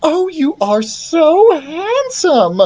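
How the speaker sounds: high, drawn-out gushing; very excited queen. A narrator's reading. Queen admires her son in his armor, very excited. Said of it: "now she was very excited".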